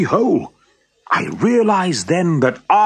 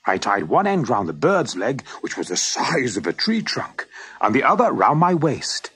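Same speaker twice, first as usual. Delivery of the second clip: ordinary delivery, nothing marked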